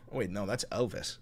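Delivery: ordinary talk, nothing marked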